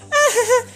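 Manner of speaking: short high-pitched mock laugh; imitated uneasy laugh. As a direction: imitate a laugh in place of an answer. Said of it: "O cara já fica meio tipo". (risadinha aguda imitada)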